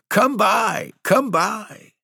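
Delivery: very high, shrill goblin hawking, falling at the end; goblin cry. Acted. Goblins calling to the maids to buy fruit, shrill high cry. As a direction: screech it like a goblin peddler luring buyers. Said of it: "maids heard the goblins cry".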